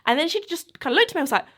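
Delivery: ordinary delivery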